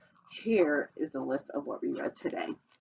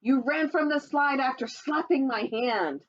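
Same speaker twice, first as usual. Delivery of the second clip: lively sing-song storybook read, higher; reading to children; 7 semitones higher than usual. Acted. Reading a dinosaur picture book to children, sing-song storytelling voice. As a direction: read the rhyme brightly to little listeners.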